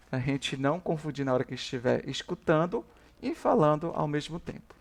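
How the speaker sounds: usual speaking voice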